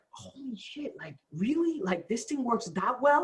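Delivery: high-pitched, hushed disbelief; soft, high "can you believe it" amazement; amazed. Retold or imitated. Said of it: "I was just amazed".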